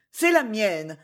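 usual speaking voice

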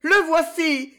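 high-pitched, terrified cry; cri d'épouvante. A narrator's reading. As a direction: cry out in dread as he appears. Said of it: "cria Violetta d'une voix d'épouvante". (cri épouvanté, très aigu)

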